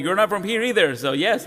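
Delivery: ordinary talk, nothing marked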